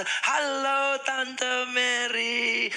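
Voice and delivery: high, very slow stretched greeting; drawn-out friendly greeting. Shown as a demonstration. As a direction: draw it out long and sweet. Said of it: "Sama Tante".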